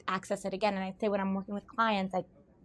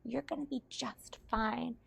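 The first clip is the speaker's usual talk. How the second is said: 1 reassuring; 4 semitones higher, 7 dB quieter, slower than usual. Retold or imitated. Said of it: "I said".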